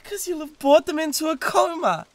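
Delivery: sneering jab in a high voice; mock the rival with a high, haughty sneer; high, catty put-down. A demon sniping at her rival over his boasts, catty.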